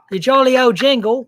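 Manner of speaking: a high, loud mock Aussie phrase; Australian accent. Husband tops his Australian accent bit. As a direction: ham up a jokey Aussie line. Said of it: "Is that a good Australian accent?".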